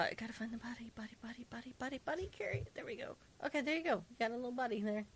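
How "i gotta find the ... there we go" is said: whispering. Real, not acted. Searching quietly while placing body.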